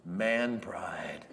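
whispered, low and slow "Man pride"; hushed aside. Shown as a demonstration. Preacher telling the congregation, whispering "Man pride". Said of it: "You know what we call that?".

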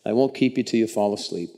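ordinary delivery

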